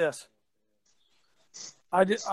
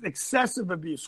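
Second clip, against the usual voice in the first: ordinary talk, nothing marked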